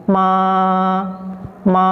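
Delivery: "ma" held long, high and flat; first-tone "ma", sustained without bending; high level tone. Shown as a demonstration. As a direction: keep "ma" steady on a high note.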